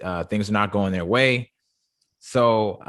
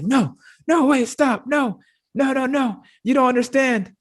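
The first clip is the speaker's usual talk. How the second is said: much higher, louder, frantic mock begging; panicked pleading MJF; 14 semitones higher, 4 dB louder than usual. Host retelling MJF begging the Inner Circle, high mock panic. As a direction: squeal out a desperate plea, near tears. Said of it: "he's almost crying".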